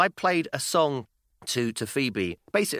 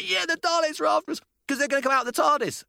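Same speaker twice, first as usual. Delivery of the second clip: put-on insistent child. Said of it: "She said".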